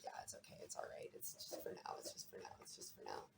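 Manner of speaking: soft unvoiced whisper, talking yourself into it; barely breathed, resigned reassurance; whispered self-reassurance. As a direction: hush it like a private excuse. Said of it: "you were just like".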